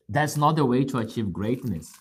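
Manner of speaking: ordinary talk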